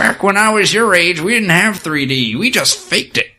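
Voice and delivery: put-on high-pitched old-timer act; mock old-timer. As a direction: play up the back-in-my-day lecture. Mock reminiscing to younger players.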